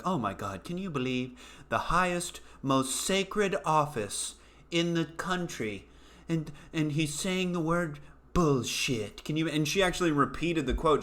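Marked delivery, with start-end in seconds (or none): 0.1-9.5 soft, mocking pearl-clutching anchor; lightly voiced prissy anchor, gasping at the word; mock news anchor. Mimicking the news anchor shocked by Trump, softly mocking. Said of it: "And she's like".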